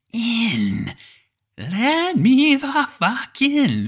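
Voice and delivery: very high, loud, drawn-out mimic cry; eerie creature calling. A narrator's reading. Creature outside the cabin calling to be let in, weirdly toned. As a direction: stretch each word like a talking cat, piercingly high. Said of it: "it just keeps calling".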